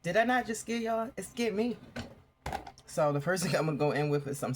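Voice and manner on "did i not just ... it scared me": startled. Real, not acted. Startled by phone voice.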